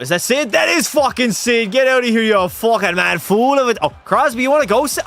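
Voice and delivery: loud, high-pitched hyped-up taunting; mock trash talk. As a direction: yell playful abuse at a rival player. Mock trash talk at an opponent in game.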